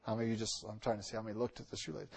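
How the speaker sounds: soft, fast aside; quiet aside. Real, not acted. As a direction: throw it away under your breath. Aside about shoelaces.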